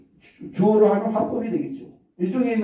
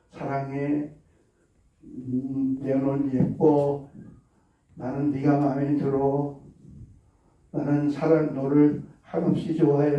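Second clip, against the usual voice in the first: soft, lower loving words to a plant; tender praise; 6 semitones lower, 4 dB quieter than usual. Shown as a demonstration. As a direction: coo fondly at the onion. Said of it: "긍정적인 칭찬과".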